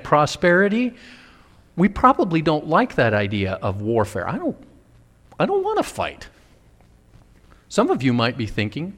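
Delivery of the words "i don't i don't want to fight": high, soft protest; mock-reluctant little high voice; reluctant whine. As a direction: plead it small and high, wanting no part of it. Voicing a reluctant churchgoer's objection.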